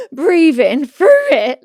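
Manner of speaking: a high, loud gasp, then breath pushed out slowly; on the bridge, acting out her panic attack with gasping breaths; panicked gasping. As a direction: act out struggling to breathe through panic. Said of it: "So then I'm like".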